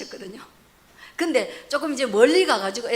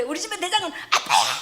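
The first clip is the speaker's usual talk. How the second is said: high mock yell with laughter in it; comic retold scolding; imitated shouting; 7 semitones higher, 4 dB louder than usual. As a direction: play the blustering dad, barely holding back laughter. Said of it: "그러면서 막 소리 지르는데".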